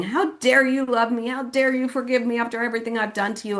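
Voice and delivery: high, pleading imitation of a crying father; tearful reproach. Dad asks daughter how she can forgive him, acted tearful protest. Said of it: "He was crying".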